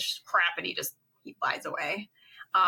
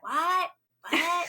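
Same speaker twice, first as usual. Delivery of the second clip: startled what; 10 semitones higher, 3 dB louder than usual. Retold or imitated. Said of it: "He's like".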